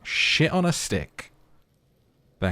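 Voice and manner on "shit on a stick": high, slow, drawn-out curse; exasperated exclamation. Acted. Voicing Harry's exclamation.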